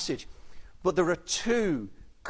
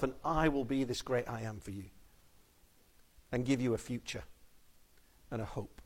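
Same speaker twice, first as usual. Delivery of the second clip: hushed close; 5 semitones lower, 8 dB quieter than usual. Real, not acted. Sermon close, fading hush.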